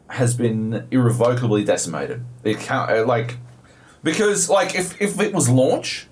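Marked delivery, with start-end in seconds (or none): none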